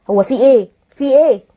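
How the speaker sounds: loud outraged "what's going on"; exasperated. Real, not acted. Host outraged at the husband, loud and exasperated. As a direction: throw up your hands and demand an answer.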